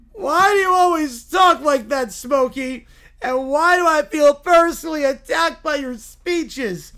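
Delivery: loud, high-pitched indignant complaint; whiny Little Bear. Acted. Little bear objecting to Smokey's speeches, loud whine. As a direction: protest like an aggrieved cub.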